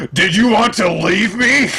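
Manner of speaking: high, loud needy demand; high loud accusing question; clingy Big Mom. Retold or imitated. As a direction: snap from sweet to threatening. Big Mom sudden mood swing.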